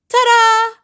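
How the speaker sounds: big, high triumphant flourish; loud showy ta-da. Acted. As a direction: shout it like a magic reveal. Showy self reveal.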